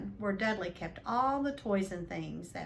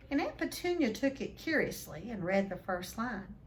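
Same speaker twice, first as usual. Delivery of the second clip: plain reading voice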